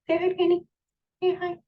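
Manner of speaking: high-pitched cooing to a little dog; pet talk. Real, not acted. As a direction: coax the dog to say hi. Coaxing her little dog on camera.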